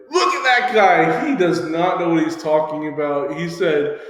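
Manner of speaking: loud, high, mocking shout; public call-out. Shown as a demonstration. Student embarrassing coach in front of everybody, loud jeering. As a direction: jeer so everybody can hear. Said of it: "start calling that person out in front of everybody".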